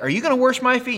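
high, incredulous squeak of a question; incredulous Peter. Retold or imitated. Preacher mimics Peter amazed at Jesus, incredulous. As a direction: play Peter gobsmacked. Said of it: "and then Peter's like".

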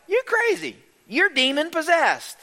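high, indignant mock accusation; incredulous accusers. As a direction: pitch it way up, scoffing at a madman. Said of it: "and they're like".

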